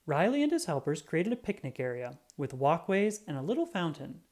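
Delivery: ordinary delivery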